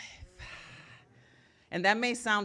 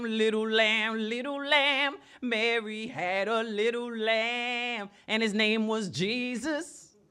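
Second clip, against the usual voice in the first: slow, higher, louder gospel preacher lady; 7 semitones higher, 6 dB louder, slower than usual. Retold or imitated. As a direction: preach to the kids like a church lady. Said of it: "she said".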